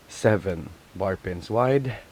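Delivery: normal talking voice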